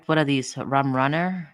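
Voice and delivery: ordinary talk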